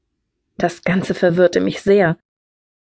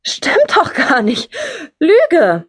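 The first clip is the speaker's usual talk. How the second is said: high, drawn-out indignant denial; outraged child calling out a lie; loud outcry; 10 semitones higher, much slower than usual. A narrator's reading. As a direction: cry out in protest, pitched up and slow. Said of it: "rief ich laut, noch hustend".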